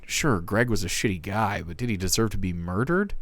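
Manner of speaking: ordinary talk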